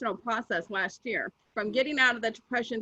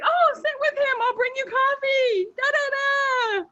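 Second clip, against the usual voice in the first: high mock voice of friend; 10 semitones higher than usual. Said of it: "She's like".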